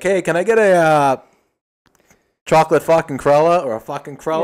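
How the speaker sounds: loud retold drive-thru order. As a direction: call the order out loud, half joking. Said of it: "I was like".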